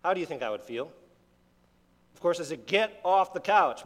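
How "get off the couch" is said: louder, higher and slow, a firm command; stern dad order. Retold or imitated. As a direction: order the boy off the couch. Dad ordering son off couch.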